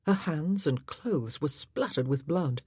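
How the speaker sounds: usual speaking voice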